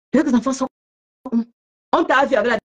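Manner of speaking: normal talking voice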